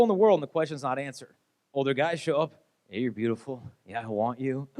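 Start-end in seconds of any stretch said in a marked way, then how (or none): 2.9-4.7 low, smooth pickup voice. Retold or imitated. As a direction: imitate a smooth charmer, pitch dropped.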